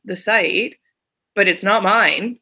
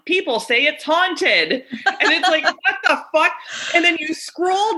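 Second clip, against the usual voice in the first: high, laughing what-the; cracking up at the absurd listing; real laughter breaking into the words; laughing disbelief; 9 semitones higher than usual. Real, not acted.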